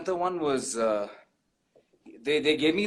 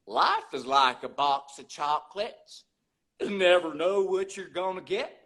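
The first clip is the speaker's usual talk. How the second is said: Forrest Gump. Acted. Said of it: "you also spoke about Forrest Gump".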